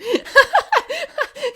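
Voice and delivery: high burst of giggling; high shocked giggle; high laugh. Real, not acted. Laughs at fetish joke.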